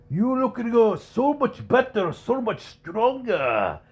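put-on high, slow Japanese-accented old man; hammed-up accent, praising. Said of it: "stereotypical Japanese accent".

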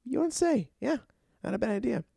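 imitating Larry's deadpan sarcasm; Larry's dry reply. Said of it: "He's like".

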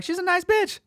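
joking, very high-voiced comeback; high-pitched protest. Speaker joking back when accused of name-calling, high-pitched protest. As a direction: send the voice way up in mock defence. Retold joking defence to the woman.

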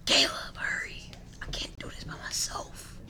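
hushed urgent whisper; unvoiced plea to a friend. Real, not acted. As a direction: whisper it so nobody hears. Alone, calling partner for help.

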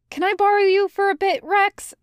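high-pitched, hesitant request; trailing off. A narrator's reading. Anakin asking to borrow Rex, high voice trailing off. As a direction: start quickly and high, then let it trail away. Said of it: "he trails off".

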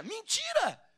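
high shout of liar; calling out a lie. Real, not acted. Preacher shouting down the backslider's denial, high. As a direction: throw it back high.

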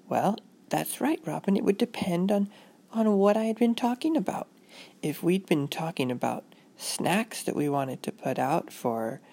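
lightly voiced motherly lilt; walk a child through it gently; mild teaching-mom voice; patient mother.

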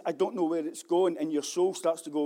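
ordinary delivery

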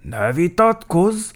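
loud, measured official reprimand. A narrator's reading. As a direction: lay down the law formally. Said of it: "mondta hivatalos hangon".

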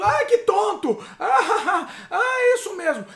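high mock laughter while talking. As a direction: ham up silly laughing at a video.

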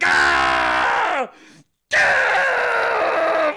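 loud drawn-out strained screams; mock agonized groans. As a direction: scream as if crushed by the load. McCain straining under the load.

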